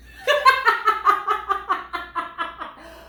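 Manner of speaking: high, loud peal of laughter; helpless laughing fit. Real, not acted. Reader cracks up over a mispronounced word, helpless laughter. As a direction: burst out laughing in a long run.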